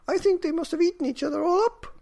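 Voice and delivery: put-on very high, loud and fast old woman; old woman fearing the cats ate each other. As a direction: blurt it as the worried old woman. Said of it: "said the very old woman".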